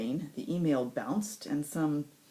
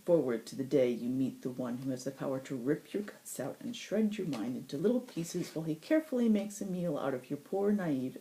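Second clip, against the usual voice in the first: usual speaking voice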